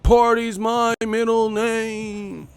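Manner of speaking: high, slow goofy party guy; Bob voice. Retold or imitated. Playing Bob bragging about parties. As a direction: drawl it like a dork who loves parties. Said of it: "I heard there was a party, and I said".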